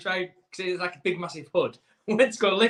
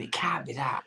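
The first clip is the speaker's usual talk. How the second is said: whispered thought; 4 semitones lower, 8 dB quieter than usual. Retold or imitated. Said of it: "I said it to you thinking".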